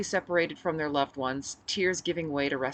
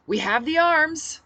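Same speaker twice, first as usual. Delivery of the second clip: rousing speech; 12 semitones higher, 8 dB louder, slower than usual. Acted. Speech over cheering crowd.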